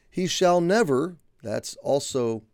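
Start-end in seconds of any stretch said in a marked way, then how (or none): 0.1-1.2 "never" pitched high and hit loud; emphatic NEVER. Shown as a demonstration. Preacher quoting the promise to the righteous, emphatic "never". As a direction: lift and punch "never" with full conviction. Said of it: "emphatic and it's comprehensive".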